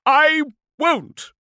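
very high, loud yell; strained defiant shriek; desperate yell. A narrator's reading. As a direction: yell your refusal. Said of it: "he yelled".

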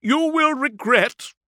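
high, loud, slow shout cut short; straining defiant cry; blustering threat. A narrator's reading. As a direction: launch a grand threat and stop. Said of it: "Udo sought refuge in bluster".

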